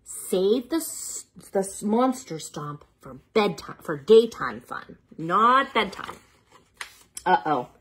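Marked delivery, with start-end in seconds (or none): none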